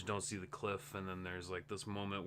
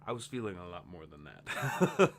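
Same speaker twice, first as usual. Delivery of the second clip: laughing; 3 semitones lower, 5 dB louder than usual. Real, not acted. Laughing at his own understatement.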